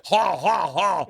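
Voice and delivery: high, cocky mock contestant patter; dating show bachelor. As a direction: pitch an outrageous date with hammed-up glee. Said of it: "And I just go".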